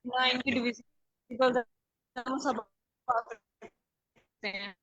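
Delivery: breaking up on a bad call line; words dropping out over poor connection; voice cutting out. Real, not acted. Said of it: "आपकी आवाज़ cut रही है".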